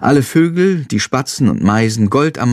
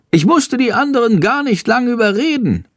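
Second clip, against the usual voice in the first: high, chirpy chatter; chattering bird; 11 semitones higher than usual. A narrator's reading. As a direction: Schandor babbling happily to Jendrik. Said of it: "plappert Schandor". (hohes, plapperndes Vogelstimmchen)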